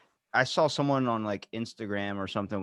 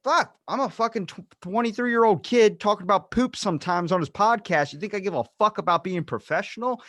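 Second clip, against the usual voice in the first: high, louder exasperated rant; exasperated outburst; 8 semitones higher, 4 dB louder than usual. Retold or imitated. Answering someone calling him unprofessional, high exasperated outburst. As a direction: throw it back at them, fed up. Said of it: "I'm like".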